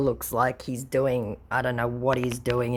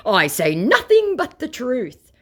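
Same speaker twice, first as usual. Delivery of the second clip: higher, slow, smug scammer voice; mock scammer; 10 semitones higher, 5 dB louder than usual. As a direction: act the self-righteous conman. Scammer's quoted reply.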